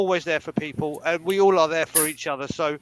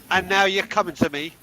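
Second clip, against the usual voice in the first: normal talking voice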